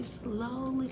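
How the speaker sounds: ordinary delivery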